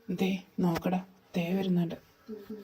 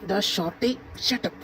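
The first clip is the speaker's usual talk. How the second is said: plain reading voice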